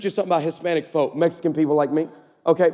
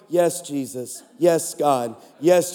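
murmuring assistant; 7 semitones lower, much slower than usual. Retold or imitated. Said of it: "who I can hear going".

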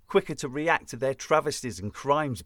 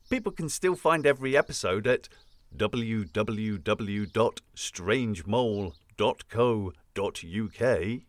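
slow clear plug; 3 semitones lower than usual. Acted. Plugging website clearly.

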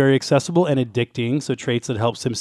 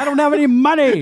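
high-pitched, hammed-up frustrated player; mock exasperation; 14 semitones higher, 4 dB louder than usual. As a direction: protest at the game in a high, exasperated voice. Acted exasperated player answering game.

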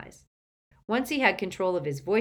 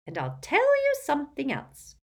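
put-on gossipy old aunt; 10 semitones higher, 5 dB louder than usual. Muriel voiced.

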